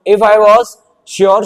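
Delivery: very slow, drawn out if I was sure; teacher reading out the sentence very slowly; slow dictation. Shown as a demonstration. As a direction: dictate if I was sure word by word so students can write. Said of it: "अब मैंने यहाँ पे लिख दिया".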